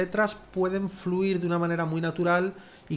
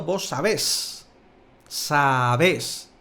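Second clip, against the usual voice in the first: "sabés" slow, with the force on -BÉS; drawn-out sa-BÉS; stress on final syllable; 3 semitones lower, 3 dB louder, much slower than usual. Shown as a demonstration. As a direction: lean hard on the end of "sabés". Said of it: "la fuerza la está poniendo en la última sílaba".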